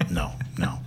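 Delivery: whisper. Real, not acted. Denying any tapes exist.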